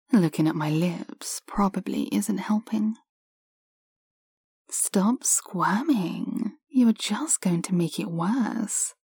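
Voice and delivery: whispering. Acted. Teasing whispered instructions.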